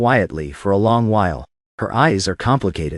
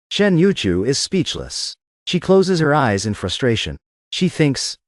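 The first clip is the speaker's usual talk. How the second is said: plain reading voice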